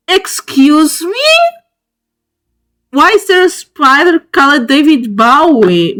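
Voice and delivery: high, astonished double-take; incredulous. Real, not acted. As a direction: question it in amused disbelief. Astonished by a spider's name.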